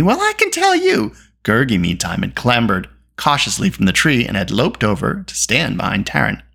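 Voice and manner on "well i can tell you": high-pitched affront; outraged girl, voice shooting up; indignant shriek. A narrator's reading. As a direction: shriek it in offended protest. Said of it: "shrieked Eilonwy".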